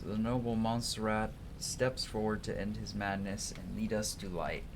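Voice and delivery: ordinary talk, nothing marked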